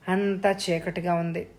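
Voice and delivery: usual speaking voice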